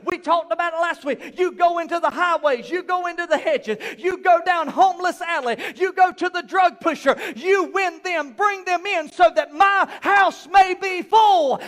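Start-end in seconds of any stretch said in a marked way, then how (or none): 1.4-11.6 louder, higher fiery preaching; full-force preaching. Acted. Pastor preaching hard to his congregation, loud and rising. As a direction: drive it home to the congregation.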